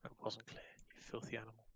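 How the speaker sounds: voiceless whispered mock insult; whispered retort. Player answering the rules explainer's clarification, whispered mock insult. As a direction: whisper it under your breath.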